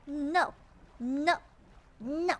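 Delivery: loud, urgent no's; loud protest. Real, not acted. Gamer refusing to drift off course, loud no's. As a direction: shout it down, refusing. Panicked protest while playing.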